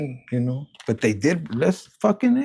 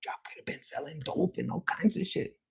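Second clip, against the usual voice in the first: hushed unvoiced whisper; 11 semitones higher, 9 dB quieter than usual. Real, not acted. Reader tells viewers about dope, hushed whisper. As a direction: lean in and whisper it like a secret.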